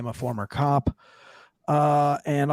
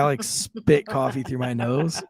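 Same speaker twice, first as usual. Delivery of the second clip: normal talking voice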